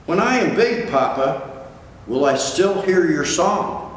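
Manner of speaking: high hopeful little whale; child voice. Acted. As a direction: ask with a small child's wonder. Child whale asks father.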